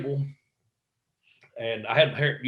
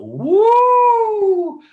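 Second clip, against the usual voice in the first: long, very high, loud "woo"; full-volume showman's holler; Ric Flair; 12 dB louder than usual. Retold or imitated. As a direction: let rip a wrestler's sustained high whoop. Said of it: "cutting a couple Ric Flair promos".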